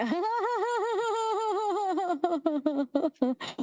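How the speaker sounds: high, long royal chuckle; high king laugh. Acted. The king laughs at his own joke, high-pitched.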